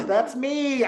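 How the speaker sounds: a sharp intake of breath, then blurt it out high; shocked gasp. Retold or imitated. Realising she is the boy.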